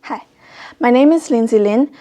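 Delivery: ordinary delivery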